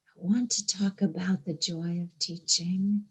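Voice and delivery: whispered, hushed and intimate. Real, not acted. To the congregation about teaching, whispered. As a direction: lean in and breathe the words. Repeated line, now whispered.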